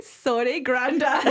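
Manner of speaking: laughing, mock-sorry "Grandad"; laughing tease. Teasing her husband about Doctor Drake, laughing. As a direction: tease him through laughter. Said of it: "I was like".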